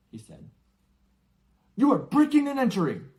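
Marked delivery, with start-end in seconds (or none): 1.8-3.1 much higher, louder indignant protest; pompous indignant uncle; blustering uncle. Acted. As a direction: puff up with outrage. Vernon protests to giant.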